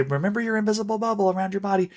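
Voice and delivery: teacher reminder. Shown as a demonstration. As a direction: lightly call back to the bubble mid-song. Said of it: "then I just say".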